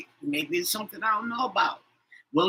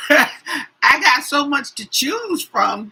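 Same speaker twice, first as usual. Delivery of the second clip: laughing; 7 semitones higher, 7 dB louder, slower than usual. Real, not acted. Laughs choosing who to read.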